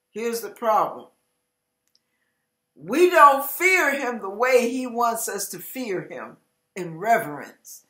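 ordinary talk, nothing marked

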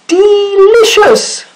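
very high, slow delighted sailor voice; high rabbit voice. Acted. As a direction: savour it in a squeaky high voice. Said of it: "he said".

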